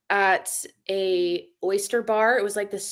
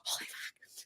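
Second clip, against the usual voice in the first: unvoiced, awed whisper; whispered amazement at a celebrity sighting; stunned whisper; 19 dB quieter, slower than usual. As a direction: breathe it out in shock.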